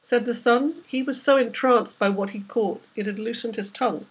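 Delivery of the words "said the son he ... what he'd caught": usual speaking voice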